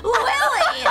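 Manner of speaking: hammed-up scolding of Willy; scolding the fisherman Willy in mock fury; mock outrage.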